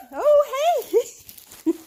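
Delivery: high, loud startled hey; surprised greeting. Real, not acted. Trainer surprised by the fast dog, high loud greeting. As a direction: jump up to a bright surprised greeting.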